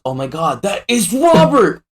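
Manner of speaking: high shocked cry of recognition; retold shocked shout. Friends watching a show suddenly recognise Robert, shocked shout. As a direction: blurt it out in sudden realisation. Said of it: "And everyone goes".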